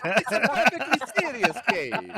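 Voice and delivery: high, loud hammed-up Italian accent; mock Italian accent. GM defends the game in a hammed-up Italian accent. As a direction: insist on seriousness in a comic Italian accent.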